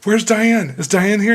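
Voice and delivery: high, loud eager asking; loud, high excited question; eager calling out. Retold or imitated. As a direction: call out for her, keyed up. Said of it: "and I go".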